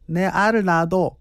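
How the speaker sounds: lightly put-on Gyeongsang dialect, tossed off; Gyeongsang accent. DJ retelling an old comedy bit, mock Gyeongsang accent. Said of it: "아니, 경상도요?".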